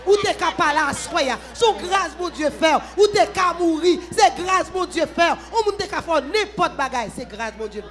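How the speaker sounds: loud, rising preacher's cry; fervent preaching. Acted. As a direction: preach it out to the congregation. Preacher builds to fervent shouting.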